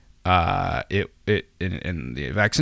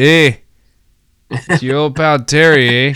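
put-on louder, higher, goofy Canadian uncle accent, eh; hammed-up Canadian accent; 5 semitones higher, 6 dB louder than usual. Uncle Terry greeting the listeners, in a hammed-up Canadian accent. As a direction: ham up a Canadian drinking buddy's hello. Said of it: "not really an accent guy, eh?".

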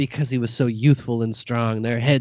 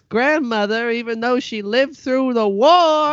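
put-on high, self-pitying old granny; guilt-trip like a martyred granny; grandma voice; 9 semitones higher than usual. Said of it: "Okay, Nana".

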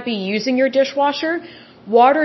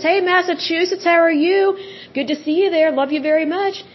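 higher, bright hello to listeners; excited greeting; 7 semitones higher than usual. Real, not acted. Host greeting Massachusetts listeners, excited and higher. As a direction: beam at a faraway friend.

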